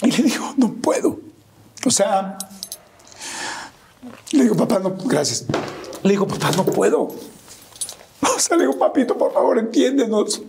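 high, choked voice slipping into whisper; real tearful breakdown. Real, not acted. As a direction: fight through tears to get words out. Teller breaks down recalling the moment.

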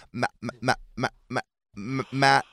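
halting syllables of a new reader; child sounding out. Retold or imitated. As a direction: imitate a beginner sounding out a word. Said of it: "watching them sound it out".

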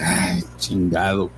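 slow, mock resigned groan of words; retold resigned dismay. Loki spots an old acquaintance, mock resigned dismay. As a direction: sigh it out slowly, imitating his dismay. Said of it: "ya lo ve y dice".

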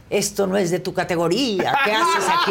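high, loud indignant heckle; retold shouting. Pita berates her friend on stage, shouting. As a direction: scold the actress from the stalls, pitch climbing. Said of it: "me empezó a gritar".

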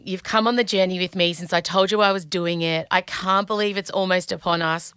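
ordinary talk

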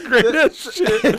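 high, drawn-out words through laughter; cracking up. Real, not acted. Praising a co-host's joke, cracking up. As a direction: stretch it out while really laughing.

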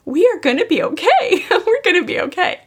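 retold high, emotional reassurance with a little laugh; tearful reassurance. Mother hugging her sobbing daughter, retold tearful reassurance. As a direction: reassure through welling emotion, voice lifted high. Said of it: "she was sobbing and I was sobbing".

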